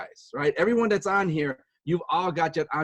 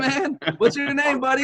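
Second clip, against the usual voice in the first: mock kid voice; 9 semitones higher, 4 dB louder than usual. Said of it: "He's like".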